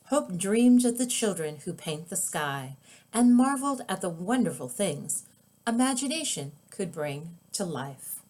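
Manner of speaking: ordinary delivery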